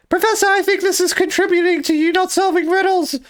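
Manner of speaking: put-on squeaky high young boy. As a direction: pitch way up like a kid. Acting Luke in skit.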